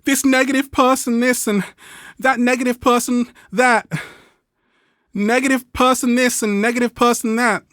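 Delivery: mock someone who always blames; whiny complainer. Imitating a finger-pointing complainer to Ralph, high and whiny. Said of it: "always pointing fingers".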